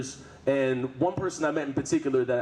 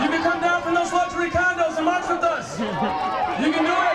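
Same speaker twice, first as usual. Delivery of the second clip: rousing union rally; 11 semitones higher, 5 dB louder than usual. Real, not acted. Shouting to onlookers at march.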